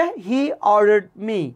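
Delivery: He ordered me spelled out word by word; teacher reading the answer slowly; slow dictation. Shown as a demonstration. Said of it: "তাহলে উত্তরটা হবে".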